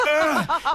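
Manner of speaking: high, strained swinging "ah"; mimed effort groan. Retold or imitated. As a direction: grunt as if pumping a swing with your legs. Mimics pumping legs on the swing.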